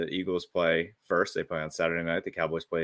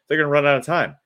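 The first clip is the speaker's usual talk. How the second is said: higher, louder mock broadcaster call; sportscaster impression, raised and loud; imitating Jim Nantz; 8 semitones higher, 8 dB louder, faster than usual. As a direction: ham up the announcer's calm certainty. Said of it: "Jim Nantz is like".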